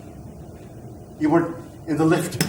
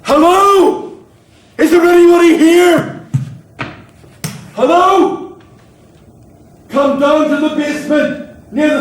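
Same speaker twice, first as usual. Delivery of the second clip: loud, high calling out for anyone; shouting; 11 semitones higher, 10 dB louder, much slower than usual. Acted. Fire chief calls out for anybody else in the basement, shouting. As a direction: holler to whoever might hear. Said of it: "Stop shouting".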